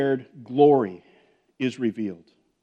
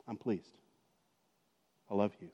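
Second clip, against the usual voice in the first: much softer, gentle father's blessing; tender; 5 semitones lower, 12 dB quieter than usual. Acted. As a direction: hush it like a loving father. God speaking tenderly to believers.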